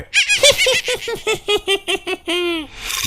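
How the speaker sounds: long, very high-pitched giggling cackle; gleeful cackle. Acted. Wife gloats at her trapped husband, high cackle. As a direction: let out a drawn-out high laugh. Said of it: "ये क्यों हंस रही है".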